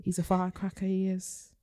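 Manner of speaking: playful put-on Geordie lilt; lightly done northern English Geordie voice; mock Geordie accent. Podcast host jokingly playing a Geordie Love Island contestant in Geordie accent. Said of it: "we have to do that accent".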